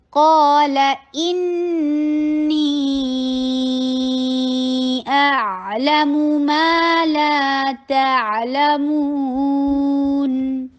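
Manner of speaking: formal Quranic recitation. Shown as a demonstration. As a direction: chant the verse in measured tajweed. Recites the verse.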